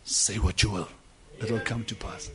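low, soft and hushed; the inner voice speaks softly to the prophet; quiet divine voice. Acted. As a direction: murmur it like a voice from within. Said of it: "And then the voice said".

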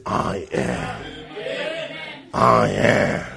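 deep, solemn voice of God, a touch low. Acted. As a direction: speak as God, grave and slow. God answering Moses.

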